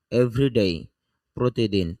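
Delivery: ordinary talk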